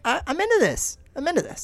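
high, gushing fan voice; excited. Retold or imitated. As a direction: squeal it like a fan. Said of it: "I was like".

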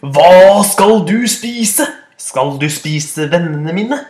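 slow loud booming roar; roaring goat. Acted. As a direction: bellow down at the troll. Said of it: "brølte den store bukken Bruse". (langsomt brølende bukk)

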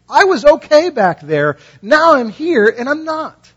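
high, loud, pleading outcry; anguished complaint. Retold or imitated. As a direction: cry out to God that it isn't fair. Voicing oppressed believers complaining to God.

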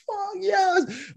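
sudden high victory cry; primal happy yell. Shown as a demonstration. Fan celebrating a big win, primal yell. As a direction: let out a cathartic yell as if your team just won. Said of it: "like, a primal yell".

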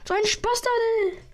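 high, loud sing-song jeer; mocking taunt. Real, not acted. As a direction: rub it in the enemy's face. Taunts an opponent in game.

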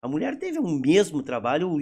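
ordinary delivery

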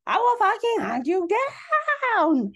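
screaming high and loud; unhinged screamed threat; furious threat. Retold or imitated. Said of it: "Lisa Rinna decides to flip out".

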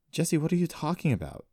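ask, lost for an explanation; confused question. A narrator's reading. Accused brother asking his sister, confused. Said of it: "looking confused".

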